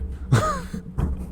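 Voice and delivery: a quiet squeaky giggle; high breathy laugh. Real, not acted. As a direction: laugh softly up high after the line. Laughing at own joke.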